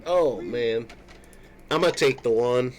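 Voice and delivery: ordinary delivery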